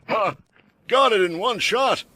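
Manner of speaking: high, proud boast. A narrator's reading. Bowman boasting to the group, proud. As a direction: crow about the shot. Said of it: "the bowman said proudly".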